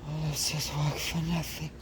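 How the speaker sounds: high, dramatic French mother mouse. Acted. New mother after birth, asking for her babies, high and dramatic. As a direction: play the weary French mother, high and theatrical.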